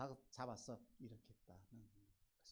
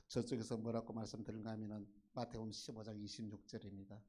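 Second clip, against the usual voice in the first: ordinary delivery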